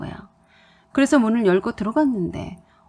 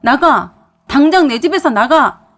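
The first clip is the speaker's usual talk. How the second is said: acted furious order, loud and higher at the end; loud shout; 4 semitones higher, 9 dB louder than usual. A narrator's reading. Husband throws wife out of his house, loud shout. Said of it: "더 크게 소리쳤어요".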